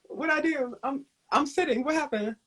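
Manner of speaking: high, mock-bewildered voice; playing confused and caught off guard; mock bewilderment. Performer acting out his puzzled reaction to the chop. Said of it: "They gave".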